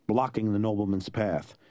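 ordinary delivery

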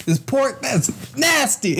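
high, drawn-out disgust; mock freak-out. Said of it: "He was completely freaking out".

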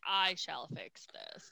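measured, mock-solemn delivery; solemn vow. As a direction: imitate a hero making a grave promise. Voices a character's solemn vow.

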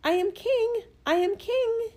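very high piping bird call; little bird calling. A narrator's reading. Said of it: "he calls from time to time".

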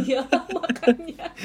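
slow, loud, laughing agreement. Real, not acted. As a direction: laugh it out in disbelief. Tertawa soal tujuh belas tahun. (tertawa geli)

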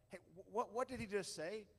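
put-on puzzled Monty Python bystander, rough English accent; mock English accent. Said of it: "I don't do the English accent very well".

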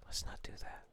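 soft breathy whispering into the mic; ASMR whisper. Pretend. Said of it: "Hold on, ready?".

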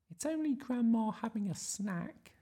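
put-on high-pitched child's voice; little Iris. Iris reassuring the lion, high childlike voice. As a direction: explain it as a small girl, high up. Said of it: "said Iris".

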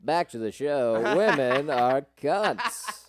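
put-on slow old-time newsreel announcer; reporter voice. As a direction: announce it like a forties radio reporter. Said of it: "your reporter voice".

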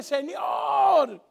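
shouted plea to the Lord, loud and drawn out; bold prayer cry. Acted. Preacher shouting a prayer for change, loud and pleading. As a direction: cry out to God for change, full force.

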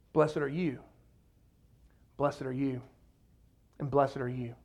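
low, soft, spaced-out blessing; hushed, gentle benediction; tender blessing. Acted. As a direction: bless each listener quietly, one by one. Voicing Jesus blessing individuals in crowd.

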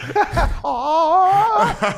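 high, crying fit of laughter; hysterical laugh. Real, not acted. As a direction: laugh till you squeal. Laughs at Mario Kart joke. (risa histérica)

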